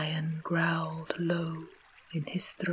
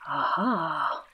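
a mildly triumphant tiger's exclamation; exclaiming tiger; 4 dB louder than usual. A narrator's reading. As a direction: exclaim it like a storybook tiger spotting prey. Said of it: "exclaimed the tiger".

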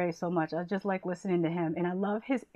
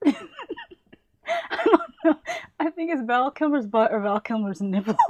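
laughing; 7 semitones higher, 4 dB louder than usual. Real, not acted. Laughing at her own joke.

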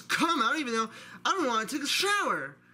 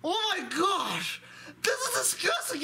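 much higher, shocked squeal; high panicked voice; 10 semitones higher than usual. Acted. Friend reacts in disgust, high panicked voice. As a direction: squeal in horror.

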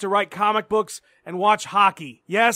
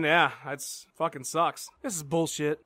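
slow, lower, droning echo of the complaint; 4 semitones lower, 7 dB quieter than usual. Acted. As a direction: drag it out low and flat like a sulky echo.

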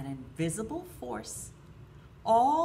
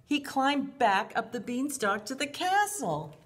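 ordinary talk, nothing marked

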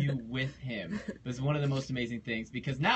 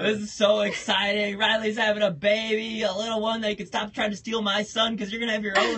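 loud, high, celebratory voice; thrilled congratulations; 8 semitones higher, 8 dB louder than usual. Real, not acted. Co-host celebrating the host having a baby, thrilled and loud. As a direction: cheer the good news loudly.

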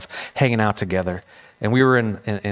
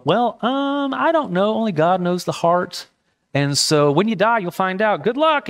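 much higher, breezy mock brush-off; mock dismissive shrug; 8 semitones higher than usual. Preacher mocking a careless answer to a new believer, breezy and dismissive. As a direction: toss it off like you couldn't care less.

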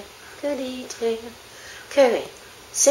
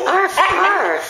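high, drawn-out "arf, arf"; mock dog bark; 7 semitones higher than usual. Owner cueing the dog to bark in the song, mock bark. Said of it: "You do the arf, arf".

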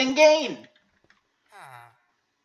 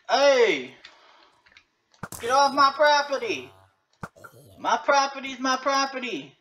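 shouting; 7 semitones higher than usual. Pretend. Yelling at zombies.

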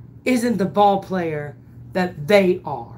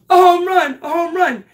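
excited realization; 10 semitones higher, 6 dB louder, faster than usual. Retold or imitated. Catcher realizing it's a home run.

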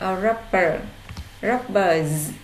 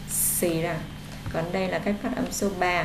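ordinary talk, nothing marked

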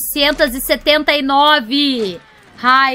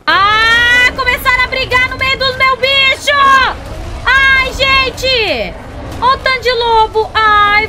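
alarmed excited; 7 semitones higher, 3 dB louder than usual. Real, not acted. Animals fighting among her creatures.

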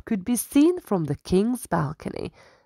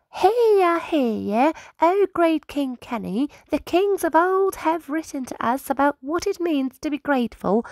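high, earnest little squirrel voice; a small scribe solemnly advising his king; high-voiced squirrel counsellor, formal; 8 semitones higher than usual.

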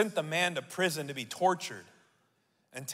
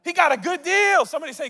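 excited; 12 semitones higher, 8 dB louder, faster than usual. Real, not acted. Preacher hypes the crowd.

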